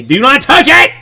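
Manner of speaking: loud high shout do not touch it; shouted warning. Retold or imitated. Dad yells at his daughter to not touch it. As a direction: snap it out as a real yell. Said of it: "and I say".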